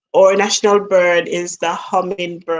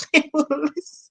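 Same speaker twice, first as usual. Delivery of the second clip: laughing; 8 semitones higher, 4 dB quieter than usual. Real, not acted. Laughing at her kids.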